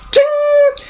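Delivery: very high ringing "ting"; high-pitched sound effect; imitated metallic ting. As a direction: voice a claw springing out. Imitates claw popping out.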